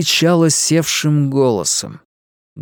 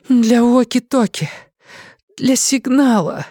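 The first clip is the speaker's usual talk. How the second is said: hoarse rasp; 11 semitones higher than usual. A narrator's reading. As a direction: squeeze it out through a hurt throat. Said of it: "могла только сипеть".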